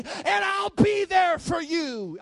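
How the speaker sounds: loud, high, slow preaching cry; shouted promise. Acted. Preacher voicing Jesus to the congregation, slow fervent shout. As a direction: stretch it out at full volume.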